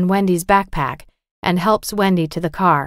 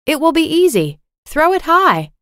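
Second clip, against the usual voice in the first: put-on high-pitched, eager young player; raised pitch, keen and sure; eager confidence; 10 semitones higher, 4 dB louder than usual. Confident sister calls for a high throw.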